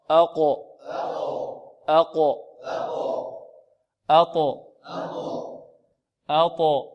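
"أقو، أطو، أب، أج، أد" each final consonant released with an echo; bouncing qalqala. Shown as a demonstration. Teacher drills students letter by letter, echoing qalqala. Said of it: "نطبق الآن".

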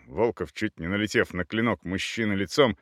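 usual speaking voice

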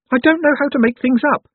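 very high, loud, anxious confession; helpless Owlswick. A narrator's reading. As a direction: insist in a high, nervous little-man voice. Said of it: "said Owlswick".

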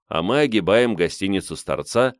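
ordinary delivery

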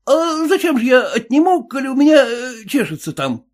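high suspicious cabbie; cab driver voice. Acted. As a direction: play the sly cabbie, pitched well up.

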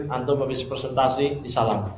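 ordinary talk

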